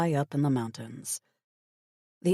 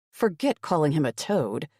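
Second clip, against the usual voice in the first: ordinary delivery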